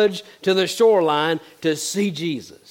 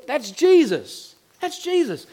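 slow then rising much higher; dawning wonder, pointing him out; excited recognition; 8 semitones higher, slower than usual. Retold or imitated. John tells Peter in the boat it's Jesus, awed excitement.

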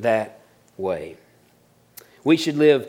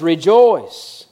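"Rejoice" louder and drawn out; stress on rejoice; 2 semitones higher, 5 dB louder than usual. Shown as a demonstration. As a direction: proclaim "Rejoice" loud and long. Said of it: "He says".

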